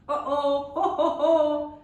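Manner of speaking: sing-song uh-oh ho ho ho; mock dismay; playful uh-oh. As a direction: tease the miss. Mosquito escapes.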